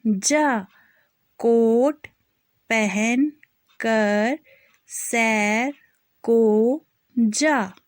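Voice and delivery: drawn-out syllables, each sounded clearly; slow spelling drill. Shown as a demonstration. Teacher spelling out a sentence for children, slow and clear. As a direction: spell it out slowly for young learners.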